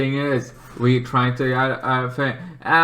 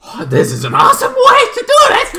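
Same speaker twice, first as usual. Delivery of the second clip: much higher and louder, fast, laughing with delight; thrilled fan; 7 dB louder, faster than usual. Retold or imitated. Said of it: "and I'm like".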